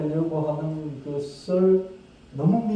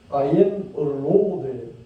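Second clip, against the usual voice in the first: slow proclamation; 3 dB louder, much slower than usual. Shown as a demonstration. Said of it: "very slow proclamation".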